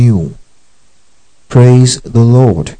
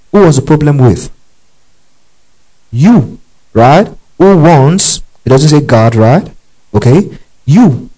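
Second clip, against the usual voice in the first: fervent preaching; 4 semitones higher than usual. Real, not acted. Pressing the congregation: who was it?.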